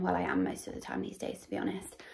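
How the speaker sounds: half-whispered confession; whispered aside. Real, not acted. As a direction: drop into a breathy aside. Whispered aside about herself.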